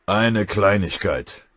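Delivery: slow, loud sarcasm; venomous grumble. A narrator's reading. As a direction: draw it out with venom. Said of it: "brummte Rosskamp giftig". (giftig, langsam und laut)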